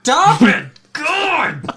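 high, loud exasperated yell; exasperated outburst. Real, not acted. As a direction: beg the teasing to stop, at the end of your rope. Friend pleads after more teasing.

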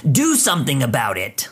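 usual speaking voice

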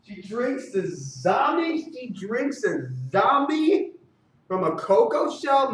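high-pitched, drawn-out incredulity; stunned whoas into slow, high questioning; astonished disbelief. Real, not acted. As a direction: question it slowly, high and amazed. Astonished reaction to zombie lyric.

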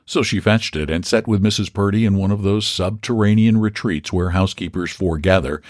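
usual speaking voice

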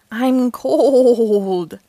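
loud, long drawn-out COLD; exclaimed complaint. A narrator's reading. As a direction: wail it out, stretching "cold". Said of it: "Daniel exclaimed".

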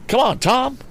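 high-pitched rallying cry. A narrator's reading. Mister Damon rallies Tom from the bushes, high cry. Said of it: "cried Mister Damon".

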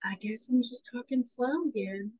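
lilting mock-Southern voice; Southern accent. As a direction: ease into a playful Southern accent. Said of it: "go down to the South and be like".

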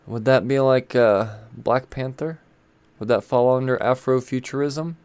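ordinary talk

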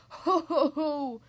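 slow, loud ho-ho laugh; booming laugh. Acted. Tickled dragon laughing helplessly, slow booming laugh. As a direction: boom out each drawn-out ho. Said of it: "boomed the dragon".

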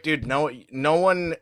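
ordinary talk, nothing marked

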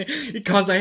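normal talking voice

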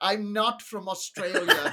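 slow, laughing fake Australian accent; odd Australian accent. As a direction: deny it in a giggly Aussie voice. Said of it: "That's an odd Australian accent you got there".

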